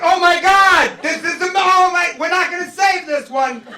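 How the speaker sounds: loud, high mock panic at the supermarket; hammed-up shouting over a dead cow. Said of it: "I'm in there like".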